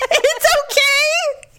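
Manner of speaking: very high, stretched-out squeak of reassurance; trying not to cry. Acted. Fallen wife reassuring husband, holding back tears. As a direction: pretend to be okay on the verge of tears. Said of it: "I'm trying not to cry".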